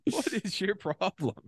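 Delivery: high, helpless laugh through the words; amused, incredulous laughter; laughing in disbelief. Real, not acted. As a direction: crack up while protesting. Cracking up at co-host's rant.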